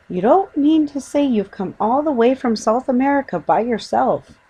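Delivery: high, loud astonished exclamation; disbelieving, amazed question; astonished mother-figure exclaiming. Acted. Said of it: "exclaimed Missus Brown".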